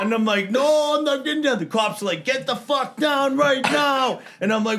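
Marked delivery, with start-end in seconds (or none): 0.5-1.6 put-on high, loud drunken refusal; refuse, cocky and loud; defiant refusal. 2.2-4.2 high, slow angry command; police order. Retold or imitated. Cops ordering him down.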